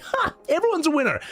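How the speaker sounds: high, chirpy cartoon voice; a goofy upbeat kid voice; mock cheerful voice. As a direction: squeak it out like a happy mascot. Mock cheerful Red XIII voice.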